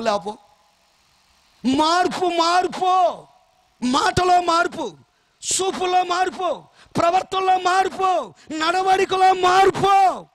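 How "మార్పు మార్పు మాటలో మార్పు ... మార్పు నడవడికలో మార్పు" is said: loud, slow, emphatic sermon delivery; forceful preaching. Acted. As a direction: drive each point home to the congregation. Preaching list of changes.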